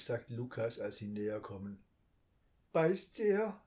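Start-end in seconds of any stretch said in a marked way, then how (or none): 2.7-3.6 anxious question. A narrator's reading. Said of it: "fragt Lilli ängstlich".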